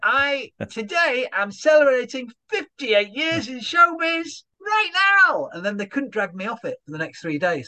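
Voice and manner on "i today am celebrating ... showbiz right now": put-on slow, high, old showbiz compere; slow, pompous old showman, rising higher; Ronnie Rigsby voice. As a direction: puff it up like a veteran entertainer announcing himself. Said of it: "my character act, Ronnie Rigsby".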